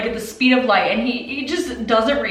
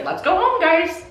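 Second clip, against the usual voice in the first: put-on higher, breezy carefree girl; mock her cheerful shrug-off; chirpy Hanabi imitation; 9 semitones higher than usual. Hanabi acted, careless.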